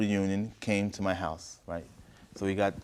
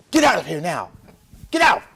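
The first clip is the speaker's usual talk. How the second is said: loud, high screamed order; angry scream; 10 semitones higher, 12 dB louder than usual. Acted. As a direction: scream to throw someone out. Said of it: "I screamed at her".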